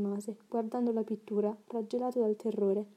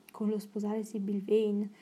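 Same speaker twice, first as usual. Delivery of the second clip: ordinary delivery, nothing marked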